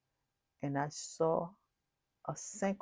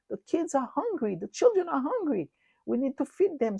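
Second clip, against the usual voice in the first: much higher and louder, urgent appeal; urgent pleading; 8 semitones higher, 5 dB louder than usual. Retold or imitated. Urging the dream mothers to feed children, high urgent plea. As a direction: press the mothers to act now.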